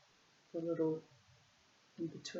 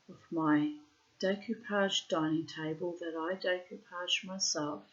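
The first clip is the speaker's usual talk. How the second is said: normal talking voice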